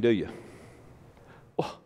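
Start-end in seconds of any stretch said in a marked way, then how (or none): 1.6-1.8 mock humble listener. Preacher mimics a listener too modest to say it, in a high whine. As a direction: whimper it like a falsely modest churchgoer. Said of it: "You say".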